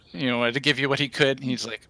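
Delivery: normal talking voice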